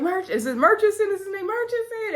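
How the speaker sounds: high, shouted name check; shouted question. Retold or imitated. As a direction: call the name out, high and puzzled. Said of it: "she shouted".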